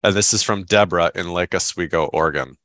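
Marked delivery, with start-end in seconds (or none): none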